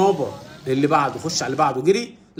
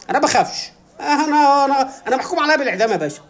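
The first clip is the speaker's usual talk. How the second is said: higher, agitated comic bravado; defiant condemned animal; 7 semitones higher than usual. Acted. Condemned animal boasting to the butcher, hammed-up defiance. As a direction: pitch it up and rattle off the boast.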